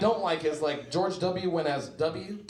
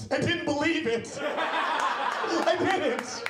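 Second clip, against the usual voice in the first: laughing mom; 9 semitones higher, much slower than usual. Retold or imitated. Mom's punchline, laughing.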